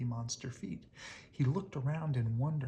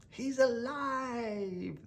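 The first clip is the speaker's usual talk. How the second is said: high, slow, thrilled cry; exclaimed; 10 semitones higher, 4 dB louder, much slower than usual. Acted. Little terrors cheer their creation, high excited cry. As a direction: stretch it out in delight. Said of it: "the little terrors exclaimed".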